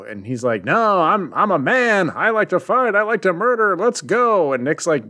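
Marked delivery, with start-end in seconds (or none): 0.6-4.5 high-pitched, hammed-up macho protest; mock macho soldier. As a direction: imitate a trigger-happy soldier insisting on a fight. Mocks Ryan's macho protest.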